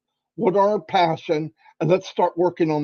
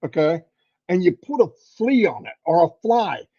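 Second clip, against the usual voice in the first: ordinary delivery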